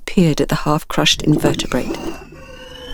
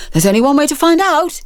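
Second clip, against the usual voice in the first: called back; 9 semitones higher than usual. Acted. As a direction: call it while running. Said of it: "he called over his shoulder".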